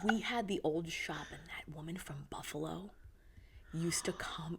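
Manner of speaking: soft, breathy whispered aside, laughing; gossipy whisper. Real, not acted. Whispering to her co-host about the Buffalo customer.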